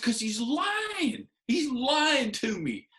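very high, drawn-out mock outrage; re-enacted exasperated whine; mad, indignant protest. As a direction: stretch out the accusation in a high wail. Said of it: "Why do you get so mad?".

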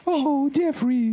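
very high, very slow, adoring sigh of a name; Shirley swooning. Retold or imitated. Proud friend swoons over the group leader, very high and slow. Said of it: "dice Shirley".

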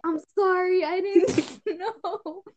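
sorry, squeezed out between laughs; giggling, slow, half-sorry; laughing apology. Real, not acted. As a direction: apologise but keep breaking up. Laughing apology retold to friend.

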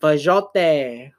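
Fajote said with a mock Spanish flourish, fa-HO-teh; mock-elegant Fajote; fancy Spanish-sounding. Shown as a demonstration. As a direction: roll out Fajote like a fancy foreign word.

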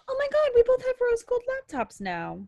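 thrilled at a coincidence; gasping surprise. Real, not acted. Host realising both hosts own rose gold laptops, gasping in surprise.

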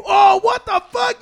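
high, loud, slow shout of disbelief; excited shout. Retold or imitated. Friend retelling his fake PS5 win, shouting with joy. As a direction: yell it like you just won the jackpot.

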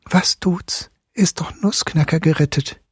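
put-on high whisper of a relieved girl; Marie's hushed inner voice; whispered thought. A narrator's reading. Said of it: "denn sie dachte".

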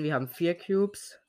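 ordinary delivery

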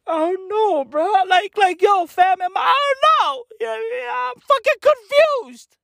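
high, hysterical half-laugh, half-cry; squeaky fake-distressed giggling rant; mock distraught laughing. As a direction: ham up a confused meltdown, cracking up. Mock breakdown over celebrity divorce.

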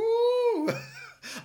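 a squeal of laughter jumping way up; high squealing laugh. Real, not acted. Laughing at his own joke, high squealing laugh. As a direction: burst into a high shrieking laugh.

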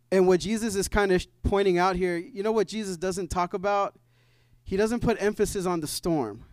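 normal talking voice

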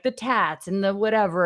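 usual speaking voice

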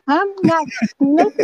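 put-on high sassy girl voice; a high prissy little-girl put-on; Girl Scout voice. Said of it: "That's a Girl Scout cookie voice".

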